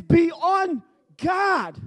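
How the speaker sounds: very slow, each word weighted; slow emphatic quote. Acted. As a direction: drag out every word of "Be on guard".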